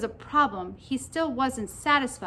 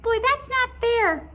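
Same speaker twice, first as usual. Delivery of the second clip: high, slow squeaky whine; drawn-out squeaky complaint; whining pigtail; 12 semitones higher, 5 dB louder, slower than usual. A narrator's reading. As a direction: whine it in a tiny high creature voice. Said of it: "whined the pigtail".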